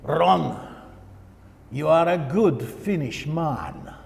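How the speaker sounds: put-on a light, slow stoic Finnish accent with a Russian hint. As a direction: lightly imitate a taciturn Finnish pastor in his Finnish accent, unhurried. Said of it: "very stoic, almost Russian accent to it".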